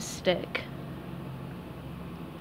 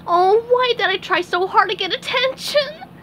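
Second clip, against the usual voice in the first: wailed; 11 semitones higher, 4 dB louder than usual. Acted. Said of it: "SpongeBob wailed".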